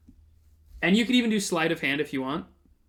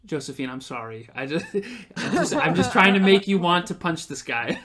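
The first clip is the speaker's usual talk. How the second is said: ordinary talk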